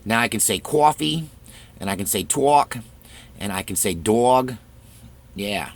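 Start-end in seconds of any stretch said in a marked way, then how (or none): 0.6-1.3 "coffee" as New York "cwaw-fee", higher; New York accent. Shown as a demonstration. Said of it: "couldn't say New York phrases". 2.2-2.8 "talk" as New York "twawk"; New York accent. Shown as a demonstration. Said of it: "couldn't say New York phrases". 3.9-4.5 "dog" as New York "dwawg"; New York accent. Shown as a demonstration. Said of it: "couldn't say New York phrases".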